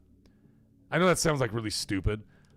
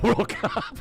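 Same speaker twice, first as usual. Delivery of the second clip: laughing through the end of a tease; breaking into laughter; 8 semitones higher than usual. Real, not acted. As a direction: crack up on the last words. Teasing viewer, breaks into laughter.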